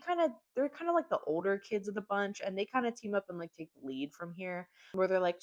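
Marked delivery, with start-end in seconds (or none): none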